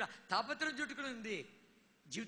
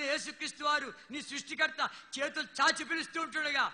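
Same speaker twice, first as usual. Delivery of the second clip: solemn preaching; 3 semitones higher, 6 dB louder than usual. Acted. Preacher calling sinners forward, solemn and building. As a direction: build it like a summons.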